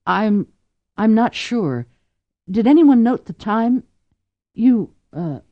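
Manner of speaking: flustered. A narrator's reading. Frightened woman after poisoning.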